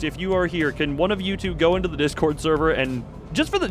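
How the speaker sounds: normal talking voice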